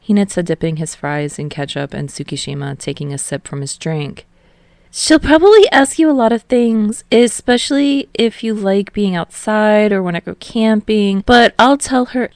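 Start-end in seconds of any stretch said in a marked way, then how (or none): 4.9-12.3 higher, bright cheerful guy voice; cheerful young man voice. A narrator's reading. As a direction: voice the upbeat friend up high. Narrator voicing Hinata.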